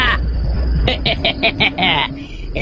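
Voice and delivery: smug villain laugh. Acted. Naib gloats over his trick.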